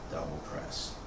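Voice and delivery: quiet unhurried cues, guiding the form; serene tai chi cues. Acted.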